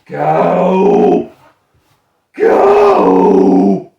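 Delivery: loud lowing "Go!" like a cow; cow moo. Pretend. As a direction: moo the word out. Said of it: "Or a cow".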